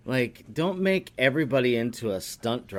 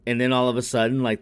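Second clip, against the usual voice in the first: ordinary talk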